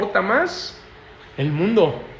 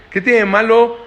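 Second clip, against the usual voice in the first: mock defiance; 5 semitones higher, 6 dB louder than usual. Children mocking a parent's old ways.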